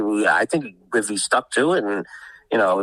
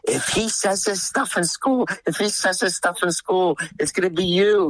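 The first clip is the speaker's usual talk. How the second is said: imitating her scolding; 9 semitones higher than usual. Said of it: "and she's like".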